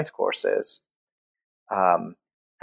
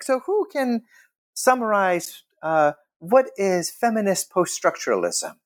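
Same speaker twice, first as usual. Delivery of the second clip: a lightly voiced professor, a bit higher; a hint of a lecturing professor putting a question; professor asking; 9 semitones higher than usual. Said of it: "a professor was like".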